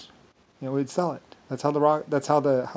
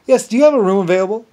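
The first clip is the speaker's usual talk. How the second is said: traveler asking; 7 semitones higher, 10 dB louder, faster than usual. Retold or imitated.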